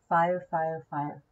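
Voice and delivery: usual speaking voice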